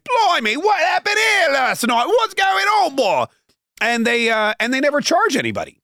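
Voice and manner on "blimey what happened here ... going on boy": mock British. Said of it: "The British guys are like".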